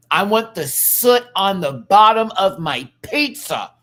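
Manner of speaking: loud, high-pitched foodie rant; impassioned demand. Real, not acted. Ranting demand for real pizza.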